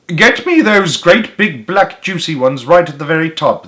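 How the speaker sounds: put-on old man's shout. A narrator's reading. Old man ordering gardener up a ladder, mild shout. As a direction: demand it with a restrained shout. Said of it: "the old man was shouting".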